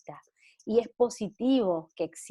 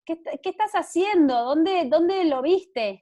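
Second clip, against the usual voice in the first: put-on raised, urgent questioning of a child; retold parental alarm; 8 semitones higher, 6 dB louder than usual. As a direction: imitate a startled dad, louder and higher. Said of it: "mi mamá casi se muere".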